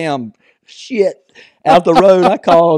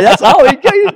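loud, high exclamation; retold outburst; 10 dB louder than usual. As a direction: throw it out high over the laughter. Retold outburst amid laughter.

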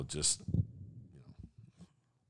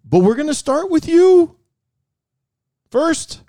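mock tough detective; 12 semitones higher, 7 dB louder than usual. Playing detective interrogating the suspect.

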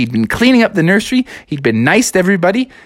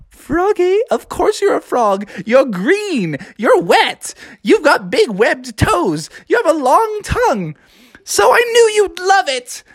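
high, prim nanny voice; Mary Poppins; 12 semitones higher than usual. Acted. Nanny telling Froggy he's obviously a frog, high and prim. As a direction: list it off in a high trill.

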